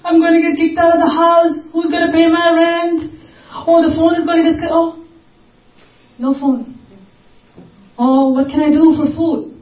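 hammed-up crying prayer to the Lord; mock crying plea. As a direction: wail it out like a helpless beggar. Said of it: "And we cry".

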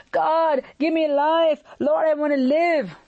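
imitating an earnest, urgent plea; pleading prayer. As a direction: cry out for your life in prayer. Acts out Hezekiah's desperate prayer.

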